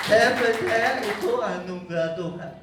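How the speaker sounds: loud proclamation. Real, not acted. Testimony of faith proclaimed. (menyatakan dengan lantang dan yakin)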